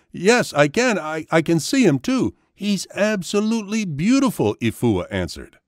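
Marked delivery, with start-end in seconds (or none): 0.1-4.6 put-on excited child. A narrator's reading. Child excitedly answers about the peacock.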